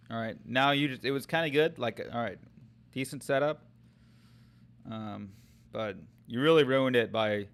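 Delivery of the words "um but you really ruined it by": ordinary talk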